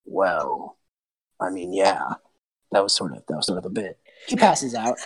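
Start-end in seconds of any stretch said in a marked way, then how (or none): 0.0-4.0 low, softer monster voice; deep, offhand admission of guilt; sardonic creature. Acted. Monster owns up to poisoning Caleb, sardonic.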